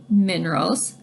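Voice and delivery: ordinary talk, nothing marked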